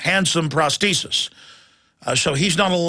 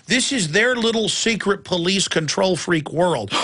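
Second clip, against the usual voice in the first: usual speaking voice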